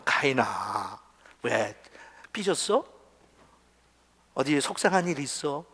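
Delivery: low, soft and gentle coaxing; tender fatherly God. Acted. God gently asking sulking Cain what's wrong, low and tender. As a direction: coax him softly like a caring father.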